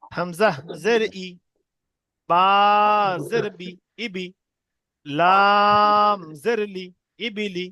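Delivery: slow drill with long-held letter names; deliberate, chant-like spelling aloud; spelling piece by piece. Shown as a demonstration. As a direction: stretch each letter, pause, then blend the syllables. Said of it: "কেটে কেটে বানান করবো".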